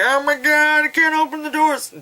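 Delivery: high-pitched panicked wail; mock freak-out. Guys trapped in the car on the tracks, mock panicked. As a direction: imitate trapped guys in hammed-up terror. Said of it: "the guys are freaking out".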